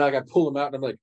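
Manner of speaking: ordinary talk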